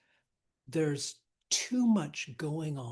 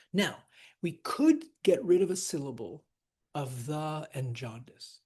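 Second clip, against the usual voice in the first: normal talking voice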